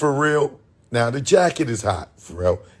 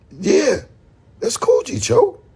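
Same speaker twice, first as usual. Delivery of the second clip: lightly voiced friend agreeing up high; a hint of excited Jay confirming; voicing Jay; 13 semitones higher than usual. Jay agreeing on the phone, lightly voiced, pitched high.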